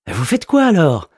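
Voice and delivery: a goofy postman who can't believe it; incredulous mailman. A narrator's reading. Postman asking if the wizard does party shows, incredulous.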